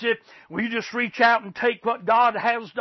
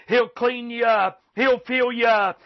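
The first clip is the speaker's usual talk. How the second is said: ordinary delivery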